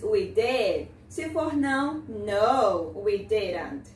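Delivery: usual speaking voice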